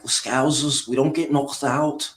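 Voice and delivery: put-on Liverpool accent; imitated Scouse accent. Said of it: "Skills right there".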